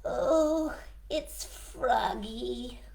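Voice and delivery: a rabbit groaning at the door; dismayed Rabbit. Acted.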